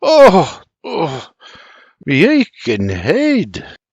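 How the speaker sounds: slow hungover groaning words; moaning. A narrator's reading. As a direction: moan over an aching head. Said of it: "he moans".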